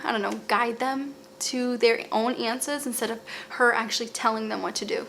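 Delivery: usual speaking voice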